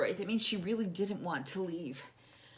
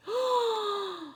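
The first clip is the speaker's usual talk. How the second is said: sharp gasp; 14 semitones higher than usual. Acted. Reacting to lost bear.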